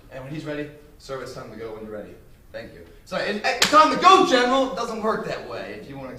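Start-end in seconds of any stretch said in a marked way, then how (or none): 1.0-2.9 quiet, measured and respectful; proper calm address. Shown as a demonstration. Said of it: "the way it should have been said". 3.2-4.7 yelled out. Shown as a demonstration. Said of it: "It's not like you just yell it out".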